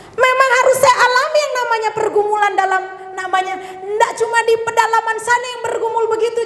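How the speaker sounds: high-pitched, impassioned preacher; sustained high sermon delivery; forceful preaching. Real, not acted. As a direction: drive the message home with rising fervour.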